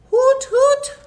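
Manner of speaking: put-on playful owl call for children; owl hoot. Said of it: "The owl says".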